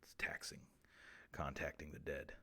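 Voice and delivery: worn out, barely voiced; drained whisper. Host drained after contacting the dead, exhausted whisper. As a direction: breathe it out, whispered.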